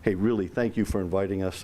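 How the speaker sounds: ordinary delivery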